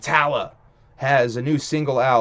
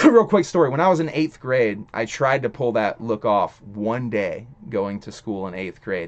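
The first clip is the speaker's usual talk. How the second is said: ordinary talk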